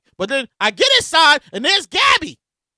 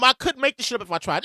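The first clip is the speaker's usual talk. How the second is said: usual speaking voice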